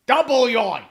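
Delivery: loud, high sportscaster catchphrase; Myron Cope. Shown as a demonstration. Fan imitating a sportscaster, excited impression. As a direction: exclaim it like a famous announcer. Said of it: "I can kinda do a Myron Cope".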